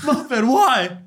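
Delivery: high, laughing mock plea. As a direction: plead with him while laughing.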